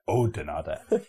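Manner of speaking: Oudenaarde as OH-denarda; U not pronounced. Shown as a demonstration. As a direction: say Oudenaarde with a pure "oh" and a sounded last E. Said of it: "You don't pronounce the U".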